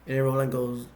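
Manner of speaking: normal talking voice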